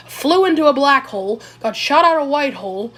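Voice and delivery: ordinary talk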